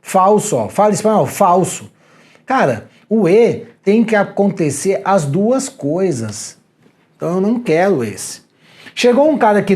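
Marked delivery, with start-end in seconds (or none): 2.4-6.6 slow, leaning on "E"; "E" stressed, every word spaced out; slow emphatic rule. Shown as a demonstration. Teacher driving the rule home to students, slow and emphatic.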